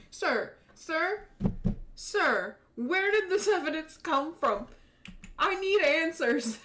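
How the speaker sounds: insistent badgering. Attorney badgering the handsome chief, loud and laughing. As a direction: pester him with mock urgency.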